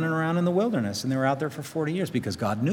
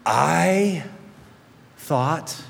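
"I" raised and held, then "thought" drawn out; stress on I thought; 4 semitones higher than usual. Shown as a demonstration. Preacher pointing at Naaman's first word, stressing "I". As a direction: lean hard on "I", pause, then "thought". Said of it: "his first word is".

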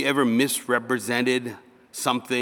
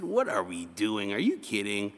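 ordinary talk

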